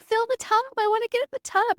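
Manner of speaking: woman in labor pleading with midwife for the water tub; desperate demand. Retold or imitated. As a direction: beg to get in the tub, voice shooting up. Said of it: "And I'm like".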